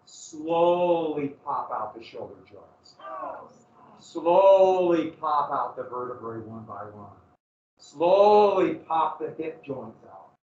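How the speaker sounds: slow grim emphasis. Acted. Dwelling on torture details.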